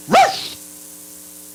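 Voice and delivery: sudden very high yelp; dog bark. Acted. Preacher plays grumbling believer, a sharp high yelp. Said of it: "I'm gonna stop barking".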